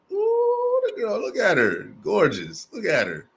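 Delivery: high falsetto ooh sliding down into admiring coos; high-pitched cooing compliments; playful hype. Real, not acted. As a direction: gush over her in a high, teasing voice. Host hypes up guest's introduction.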